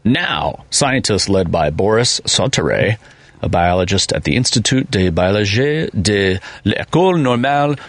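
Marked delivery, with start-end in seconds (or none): 4.3-7.8 fake French accent. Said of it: "I speak fake French".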